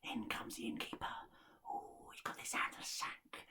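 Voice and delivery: hushed, secretive whispering; stealthy whisper. Acted. Innkeeper creeps in after the sack, whispering. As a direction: whisper so the sleeper won't wake.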